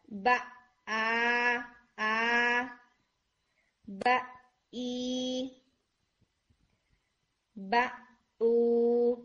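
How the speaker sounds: با then glottal آ ای او, each started in the throat; hamza vowel drill. Shown as a demonstration. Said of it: "حمزہ کی حرکہ بولیں گے تو آپ نے یہی ایریا استعمال کرنا ہے".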